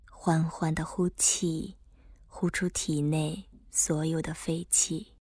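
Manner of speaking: slow guided breathing cues; slow breath counting. Acted. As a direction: pace each breath calmly.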